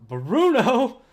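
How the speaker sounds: can't believe it's him; shocked question. Real, not acted. Surprise at the comic twist.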